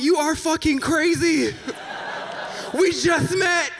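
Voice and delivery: very high-pitched outraged retort; incredulous shout. Retold or imitated. Date shouting back at host's love confession, high incredulous shout.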